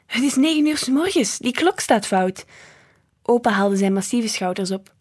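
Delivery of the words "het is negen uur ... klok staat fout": high-pitched, baffled protest; flabbergasted. A narrator's reading. Woman saying the clock is wrong, flabbergasted. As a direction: insist in disbelief, starting high. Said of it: "zei ze verbouwereerd".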